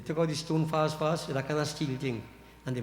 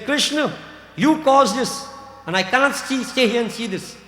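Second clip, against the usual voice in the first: higher and louder, an angry reproach; vexed outburst; 8 semitones higher, 8 dB louder than usual. Acted. Balram rebukes Krishna in a furious, raised voice. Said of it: "gets well vexed".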